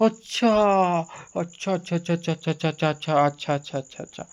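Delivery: repeated "oh right" fading away; dawning realisation. Retold or imitated. भूली बात अचानक याद आना. (धीमी पड़ती "याद आया" वाली प्रतिक्रिया)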